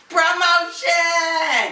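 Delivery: excited. Retold or imitated. Quoting the game show.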